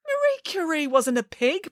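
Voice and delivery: high, exasperated protest. A narrator's reading. Headmaster objects to Nanny Piggins, high protest. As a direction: object in disbelief. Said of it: "protested the headmaster".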